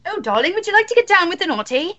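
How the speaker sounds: hammed-up British accent, pitched high; over-the-top British seduction voice. Shown as a demonstration. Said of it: "do that with the British accent".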